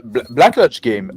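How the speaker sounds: loud shout-out by name; calling to him across the stream. Real, not acted.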